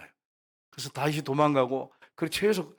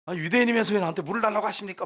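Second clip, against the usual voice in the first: mock indignant challenge, pitched up; prickly retort; 6 semitones higher, faster than usual. The woman challenging Jesus over water, prickly. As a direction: imitate a touchy woman talking back, higher. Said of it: "까칠하게 예수님한테 막 대들잖아요".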